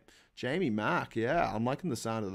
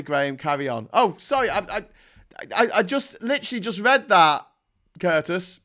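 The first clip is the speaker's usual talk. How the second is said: ordinary talk, nothing marked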